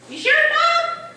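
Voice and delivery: put-on high, loud, drawn-out kid question; doubting child. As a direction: imitate an unsure little kid.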